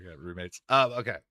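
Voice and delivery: ordinary talk, nothing marked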